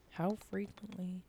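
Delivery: slow, soft ASMR voice; mock ASMR. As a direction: speak softly and slowly into the mic. Playing at ASMR into the microphone.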